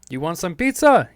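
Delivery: loud, high mock Italian offer. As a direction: belt it out like a cartoon Italian. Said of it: "the Italians were just like".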